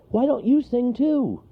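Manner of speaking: high, loud call to the bear; shouting. Acted. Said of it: "shouted Wharton".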